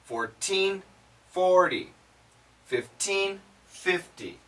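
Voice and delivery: Fourteen rising with a clear T, forty falling with a D; teen versus ty contrast. Shown as a demonstration. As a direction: drill Fourteen against forty. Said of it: "the easy way to tell the difference".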